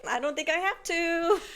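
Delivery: high, deadpan comeback; dry mom reply. Retold or imitated. Mother imitating her dry answer to her daughter. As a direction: tease the daughter gently. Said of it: "And I was like".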